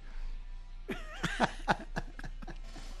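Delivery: breathy laughter, rising high. Real, not acted. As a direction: laugh breathily at his own joke. Laughs at his own joke. (risa aireada y aguda)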